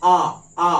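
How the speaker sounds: short loud "আ", lips never closing; open-mouthed attempt. Shown as a demonstration. Teacher demonstrates trying the sound open-mouthed, bare loud "আ". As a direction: try for ba with mouth wide open, only "আ" comes out. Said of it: "হাঁ করে উচ্চারণ করতে পারো কিনা".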